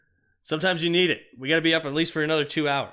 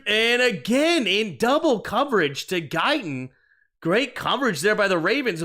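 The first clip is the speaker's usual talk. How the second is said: higher, excited game call, stretching the big moment; play-by-play; 6 semitones higher, 6 dB louder, slower than usual. Acted.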